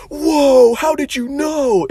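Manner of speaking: mock amazement. Short balding client amazed by the psychic, hammed-up awe. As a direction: ham up a gullible guy's awe. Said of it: "And he'll be like".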